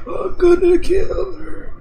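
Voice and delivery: mock demon threat; possessed demon voice. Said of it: "talking in, like, a fucking hoarse, deep voice".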